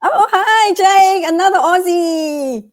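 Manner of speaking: light up at a fellow Aussie; excited greeting. Real, not acted. Greets a fellow Australian viewer.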